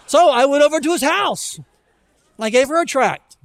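amused outburst; excited rush. Real, not acted. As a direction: tell it like a funny punchline. Laughing punchline of the story.

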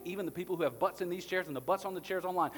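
loud, driving sermon delivery; preaching at full force. Acted. Pastor to the church about the cross, loud fervent preaching. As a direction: hammer it home to the congregation.

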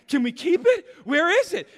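put-on like a kid begging to keep a pet; excited child.

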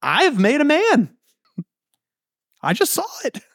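very high, hammed-up amazement; astonished. Imagined Eve just after giving birth, astonished. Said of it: "she could very legitimately be like".

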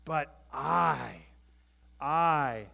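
"I" drawn out and loud; emphatic I. Acted. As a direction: lean hard on the word "I". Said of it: "this is an emphatic use".

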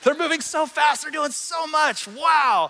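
high, loud mock amazement; hammed-up screamed wonder; excited yelling. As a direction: shout it like a hyped-up YouTube fan. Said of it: "who's screaming at me going".

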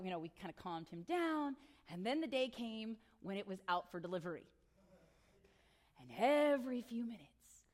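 ordinary delivery

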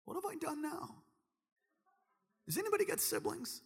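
put-on high, soft indignant kid brother; a younger sibling protesting, voice up; mock-hurt kid brother; picked-on little brother. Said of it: "Be like".

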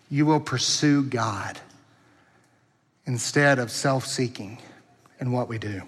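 soft, low, slow prayerful voice; hushed pastoral blessing, slow and earnest; earnest closing prayer. Acted. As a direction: pray it softly over them. Pastor prays over congregation.

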